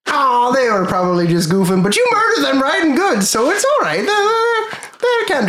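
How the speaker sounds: high-pitched ship captain sliding into a light Irish lilt; high, slightly Irish captain. Acted. Game master voicing the captain to the party, high and a little Irish. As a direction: play the cheerful captain high with a hint of Irish. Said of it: "high, and then it sorta slipped into, like, a little Irishy".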